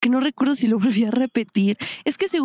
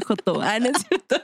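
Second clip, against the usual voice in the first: ordinary talk, nothing marked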